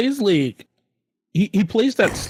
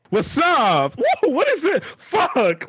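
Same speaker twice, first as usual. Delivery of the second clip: mock alarm; 12 semitones higher, slower than usual. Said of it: "she's just like".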